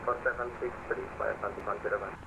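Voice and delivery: over a pilot headset. Real, not acted. Pilot reading back over radio.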